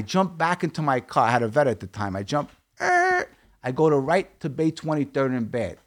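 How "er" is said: high, held screech noise by mouth; imitated tire screech. Storyteller jumping in his car to flee, screeching noise. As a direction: mimic a car tearing away.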